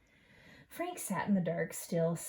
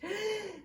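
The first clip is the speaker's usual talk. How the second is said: sharp high gasp for air; a small choking gasp as she comes to; baby bird gasp; 13 semitones higher than usual. A narrator's reading. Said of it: "then wheezed".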